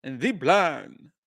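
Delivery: slow, hammed-up mock Jamaican lilt; mock Jamaican accent. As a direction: drag "the plan" out in a teasing Jamaican accent. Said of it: "pulling out her Jamaican heritage".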